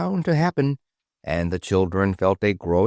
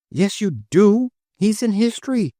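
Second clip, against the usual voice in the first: much higher and louder, insisting; insistent high retort; 12 semitones higher, 4 dB louder than usual. Acted. The Great Seal insisting Kathy knows him, high. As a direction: shoot back high and sure.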